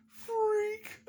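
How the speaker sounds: very high, loud mock shriek; hammed-up squeal on one word; jock's panicked shout. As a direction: yelp it like a spooked bully. Repeats the fleeing jock's cry.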